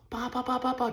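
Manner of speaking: high staccato imitated pelting; rapid ba-ba rock impacts. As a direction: pop out each hit like gunfire. Imitating rocks hitting rig.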